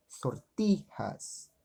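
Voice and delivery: "sortijas" drawn out, stressed on TI; stress on the penultimate. Shown as a demonstration. Teacher giving an example of llanas, "sortijas" slow with a held TI. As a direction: say "sortijas" slowly, holding the middle syllable. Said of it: "elas têm a sílaba tônica concentrada".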